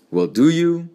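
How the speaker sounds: loud, high insistent question. A narrator's reading. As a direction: press the boy for an answer, pitch jumping up. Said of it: "he insisted".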